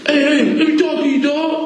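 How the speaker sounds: a hint of a street buddy pulling him aside; imitating a buddy. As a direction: mimic the guy calling you over, up high. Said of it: "The motherfucker say".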